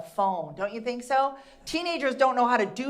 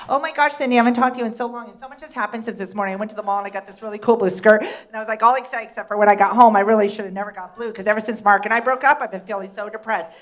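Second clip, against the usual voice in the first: put-on fast, loud gushing valley girl; rambling teenage girl; 6 dB louder, faster than usual. A teenage girl gushing to her friend on the phone, fast and loud. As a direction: ramble on breathlessly like a gossiping teen. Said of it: "They're like".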